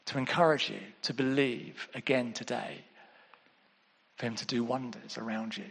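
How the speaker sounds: hushed, low and gentle; quiet pastoral pleading with long pauses; tender appeal. Real, not acted. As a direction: drop to a soft, near-whispered encouragement. Tender appeal after stirring preaching.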